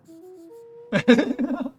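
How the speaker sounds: high, loud giggle; laugh. Real, not acted. Player tired of the prisoner, high laughing.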